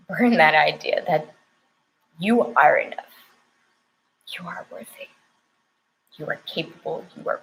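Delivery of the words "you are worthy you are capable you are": breathy whispered affirmation, barely audible. Real, not acted. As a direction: whisper it as a tender secret.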